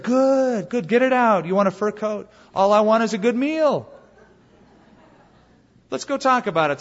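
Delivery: soothing Russian voice. Acted. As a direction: sweet-talk the hunter like a smooth Russian bear. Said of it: "in a very soothing Russian voice".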